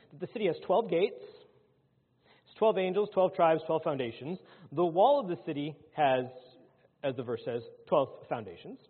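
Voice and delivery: loud, driving pulpit list; forceful preaching. Acted. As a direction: hammer out each item to the congregation. Preacher hammers the list of twelves.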